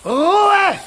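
high, loud, slow roar; thundering shout. Acted. Chief witch silencing the noisy witches, loud slow roar. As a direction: silence the crowd with a bellow.

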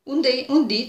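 plain reading voice

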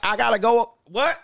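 high, loud desperate exit line; panicked plea. Retold or imitated. Worn-out guy begging to stop, panicked. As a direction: beg to quit. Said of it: "And you like".

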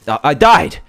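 loud, high yelp of disbelief; real, sudden dismayed shout; shocked outcry. Real, not acted. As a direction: cry out as the game kills you. Player killed after panicked fight.

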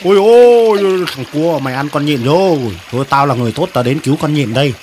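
low-pitched cartoon mantis voice; self-righteous mantis. Mantis confronting the crab to save the spider, righteous. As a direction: step in as the low-voiced rescuer.

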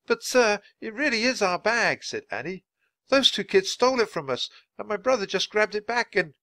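put-on high-pitched girl's plea; young girl protesting. Sister to the policeman, protesting in a high voice. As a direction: explain earnestly in a high voice, then get cut off.

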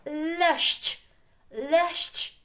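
Лящ high and loud with a crisp final щ; emphatic model word. Shown as a demonstration. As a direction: call out «Лящ» for repeating. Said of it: "Ще раз повторили слово лящ". («Лящ» високо, голосно, з чітким щ)

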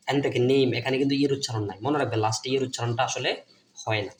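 ordinary talk, nothing marked

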